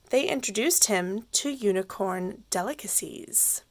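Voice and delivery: ordinary delivery, nothing marked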